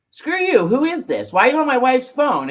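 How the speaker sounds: high, loud mock-furious husband on the phone; retold angry husband. Husband yelling at a stranger on his wife's phone, mock-furious and loud. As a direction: imitate the jealous husband snapping at the caller. Husband angrily answering unknown caller.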